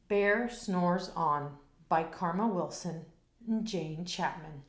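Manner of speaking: plain reading voice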